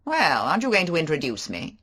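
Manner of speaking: flirtatious mocking banter; Favell. Favell voiced.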